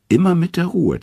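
high, light chirping voice; twittering. A narrator's reading. Loki chirping at the furious Thor, high and light. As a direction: chirp it up high to calm him. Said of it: "zwitschert Loki".

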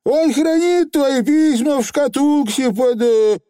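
very high little voice; faltering Feegle. A narrator's reading. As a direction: blurt on, then stall. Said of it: "попытался продолжить Явр заядло".

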